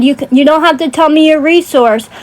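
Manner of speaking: ordinary talk, nothing marked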